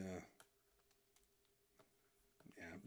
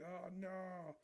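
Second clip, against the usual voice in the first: higher, fretting voice; cartoon panic; 10 semitones higher, slower than usual. Streamer voicing the guy with flaring hair, high fretting panic. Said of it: "He's like".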